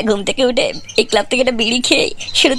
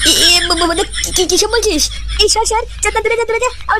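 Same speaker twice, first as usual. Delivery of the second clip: higher, stammering, scared voice; panicked fright; 6 semitones higher than usual. Acted. Shooing away the creepy stranger, panicked and high. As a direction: stutter and shoo him off in alarm. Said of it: "তুমি এত ভয় পাচ্ছ কেন".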